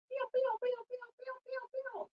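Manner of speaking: very high playful pew pews; playful pew pew sounds. Acted. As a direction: fire off cartoon laser sounds. Playful sound effects mid-class.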